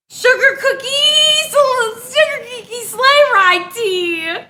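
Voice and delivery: high, drawn-out delight; delighted squeal. Real, not acted. Streamer unboxing holiday tea from a friend, delighted squeal. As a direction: stretch out each word with high-pitched glee.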